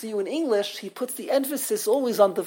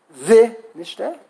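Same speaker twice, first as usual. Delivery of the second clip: VI-nishte, with VI punched louder; stress on first syllable; 7 dB louder than usual. Shown as a demonstration. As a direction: hit the opening Vi of Vi, nishte. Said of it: "pronounce this one of three ways".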